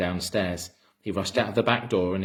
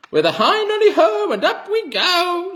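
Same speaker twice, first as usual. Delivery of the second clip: Grandma screeching triumphantly as she grows; screechy old hag; 9 dB louder than usual. A narrator's reading. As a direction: crow it out like a mad witch. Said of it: "she shouted".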